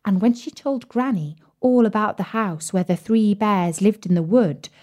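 ordinary delivery, nothing marked

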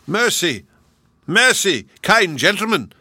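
high, loud desperate pleading; giant crying for mercy. Acted. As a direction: scream for mercy with your head in the ground. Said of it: "cried the giant".

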